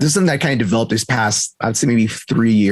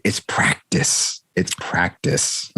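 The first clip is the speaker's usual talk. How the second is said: whispered; 4 semitones lower, 4 dB quieter, much slower than usual. Real, not acted. Repeated soft refrain.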